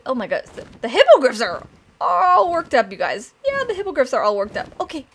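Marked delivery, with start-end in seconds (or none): none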